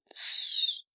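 sharp unvoiced shush. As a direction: hush her with a hiss. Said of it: "произнесла она".

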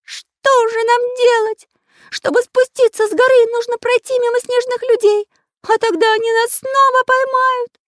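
high, loud character voice, panicking; despairing cry in a squeaky-high pitch; Карандаш in despair. A narrator's reading. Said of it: "закричал в отчаянии Карандаш". (высокий голос, в отчаянии)

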